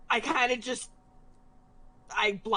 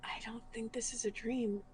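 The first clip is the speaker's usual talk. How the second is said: whisper; 9 dB quieter than usual. Acted. Quiet in-character reply.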